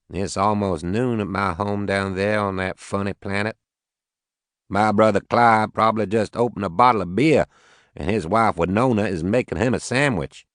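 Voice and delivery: lightly voiced Southern farmer; southern accent. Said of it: "He had a heavy southern accent".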